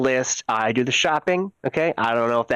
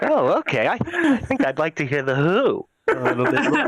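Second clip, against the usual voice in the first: laughing mock listener; 4 semitones higher than usual. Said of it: "It's like".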